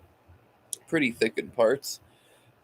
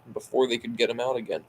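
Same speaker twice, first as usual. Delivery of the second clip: usual speaking voice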